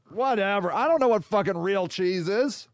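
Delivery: normal talking voice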